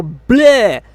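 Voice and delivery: loud retching "bleh"; disgusted bleh. Real, not acted. Reviewer reacting to terrible writing, disgusted. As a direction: blurt it out as if gagging.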